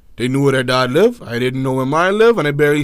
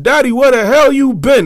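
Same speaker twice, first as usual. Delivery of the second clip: higher and louder, pushing on HELL; bold kid's demand; 10 semitones higher, 6 dB louder than usual. Retold or imitated. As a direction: kid confronting his absent father. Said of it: "the question I pop off".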